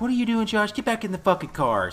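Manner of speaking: high-pitched exasperated imitation; hammed-up nagging order; mock scolding. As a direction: scold it high like an alarmed movie parent. Voices family ordering boy back.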